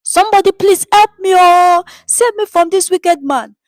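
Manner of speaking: high, loud cry for help; desperate high-pitched shouting for help; woman shouting. A narrator's reading. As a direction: scream for help at the crowd. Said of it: "the woman shouted on".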